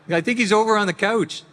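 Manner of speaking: high, loud Canadian accent. Shown as a demonstration. Voice actor demonstrating how he once talked, high Canadian accent. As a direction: push it high and hammed-up Canadian. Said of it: "I used to speak with a Canadian accent".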